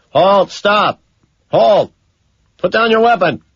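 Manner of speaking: high, loud commands barked at a fleeing man; cop shouting over a loudspeaker. A narrator's reading. Cop on the loudspeaker shouting at the running killer, high and loud. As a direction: yell it like a cop ordering a suspect to halt. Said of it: "a cop shouts uselessly".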